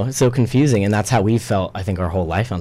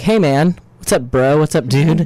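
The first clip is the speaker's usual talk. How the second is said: mock bro greeting; 7 semitones higher, 3 dB louder, slower than usual. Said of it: "you just were like".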